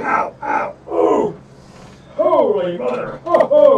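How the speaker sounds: groaning through a sudden cramp; real ows from a Charlie horse; leg cramp pain. Real, not acted. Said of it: "What the hell's wrong with you?".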